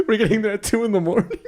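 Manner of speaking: high and quick through laughter; laughing retelling. Retold or imitated. Storyteller quoting the host about waking his boys, laughing. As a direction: crack up while quoting him.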